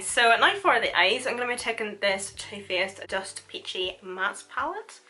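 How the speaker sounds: normal talking voice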